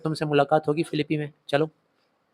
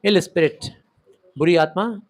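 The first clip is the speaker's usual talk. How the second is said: ordinary talk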